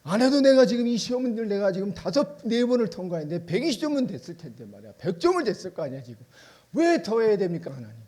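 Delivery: believer's indignant outcry at God; angry complaint. Acted. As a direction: challenge God, fed up. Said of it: "눈이 화가 나요".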